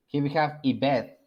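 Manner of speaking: ordinary delivery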